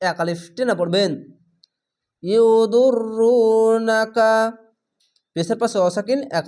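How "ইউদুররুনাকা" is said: slow drawn-out tajweed chanting; Quran recitation. Shown as a demonstration. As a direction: recite it slowly, stretching the vowels. Recited word.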